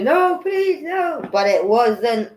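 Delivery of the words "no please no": pleading. Retold or imitated. Retelling Bill Gates fighting someone, high pleading. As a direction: beg again, less forcefully. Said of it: "'Cause he was".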